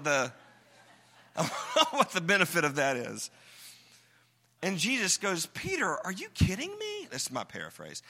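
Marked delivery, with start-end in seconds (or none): 5.5-7.1 high, incredulous scold. Acted. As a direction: act like you can't believe what he did. Jesus scolding Peter, paraphrase.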